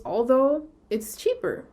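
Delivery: ordinary talk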